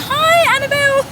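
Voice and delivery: very high, loud mock greeting; imitating a keen fan. Vlogger mimics the valet greeting her, high and loud. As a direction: mimic an over-eager hello. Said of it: "And he was like".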